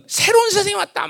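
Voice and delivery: high-pitched mock herald's announcement; propaganda proclamation. Preacher mimics a conqueror scattering leaflets, high proclaiming voice. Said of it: "이렇게 막 선전해야 될 텐데".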